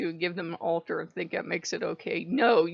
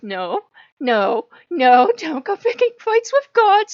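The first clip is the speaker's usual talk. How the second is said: high, louder pleading Loki voice; 9 semitones higher, 12 dB louder, slower than usual. Retold or imitated. Loki begging her not to fight gods, pleading. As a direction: beg her to stop, voice raised high. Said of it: "And Loki's like".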